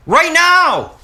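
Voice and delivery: high, loud insistence on NOW; emphatic shout. Real, not acted. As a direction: shout it to make viewers look. Repeats it emphatically to viewers.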